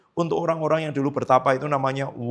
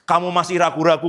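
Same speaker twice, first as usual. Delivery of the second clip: ordinary delivery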